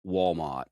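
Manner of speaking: "Walmart" with no R, like "wall-maht"; old Virginia "Walmart", ending "maht"; old-school Virginia pronunciation. Shown as a demonstration. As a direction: drop the R in "Walmart". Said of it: "a true old school Virginia accent".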